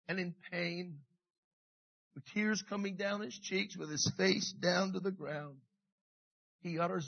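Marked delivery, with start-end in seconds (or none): none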